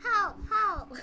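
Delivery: high mock plea breaking into laughter; playful cry for help. Joking about the comments, high laughing mock plea.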